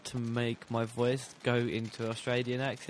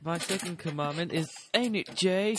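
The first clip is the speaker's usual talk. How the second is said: mock preacher; 7 semitones higher than usual. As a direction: ham up a prophet's pronouncement. Mock commandments about [name].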